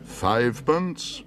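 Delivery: slow, disbelieving question; incredulous repeat. Acted. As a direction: repeat it as if you misheard. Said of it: "repeated the auctioneer".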